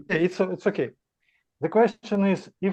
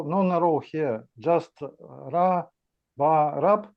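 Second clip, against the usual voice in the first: normal talking voice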